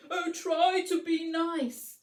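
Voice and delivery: a timid plea for mercy; lion's whimper. Acted. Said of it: "he whimpered".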